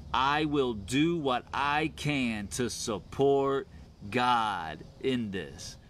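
slow, drawn-out pledge, falling at the end; deliberate declaration. Acted. Preacher modelling the Hebrew way of thinking, slow and deliberate. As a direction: give each word room, then settle downward.

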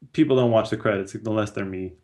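usual speaking voice

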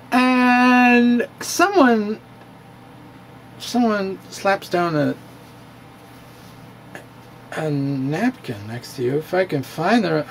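slow, slurred tipsy drawl; drunk. Pretend. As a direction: talk like you've had a few too many. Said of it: "and you were pretty drunk".

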